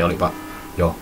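ordinary talk, nothing marked

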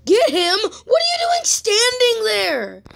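high, drawn-out yell of disbelief; exasperated yell. Real, not acted. Gamer to his own defense, exasperated high yell. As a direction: yell at your useless players.

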